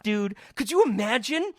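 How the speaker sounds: normal talking voice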